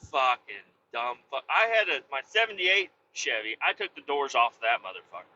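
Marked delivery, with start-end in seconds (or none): none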